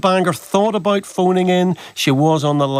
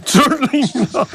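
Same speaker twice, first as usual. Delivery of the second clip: laughing echo; 10 semitones higher than usual. Real, not acted. Radio host echoing the caller's curt refusal, laughing. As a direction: repeat her words through a laugh, high and amused.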